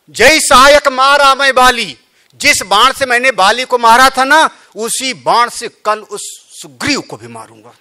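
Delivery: loud, high, angry rebuke; angry Rama. Acted. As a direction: thunder at the brother like an enraged king. Rama's anger voiced in katha.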